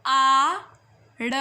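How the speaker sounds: normal talking voice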